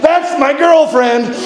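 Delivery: slow, high-pitched holler; calling out. Retold or imitated. Hiker calling to a stranger over the wind, slow high holler.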